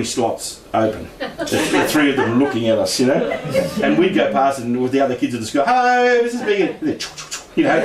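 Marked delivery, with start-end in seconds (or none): 5.6-6.7 schoolkids calling out. Retold or imitated. Schoolkids greeting the old ladies next door, calling out. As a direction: call it high like a passing schoolkid.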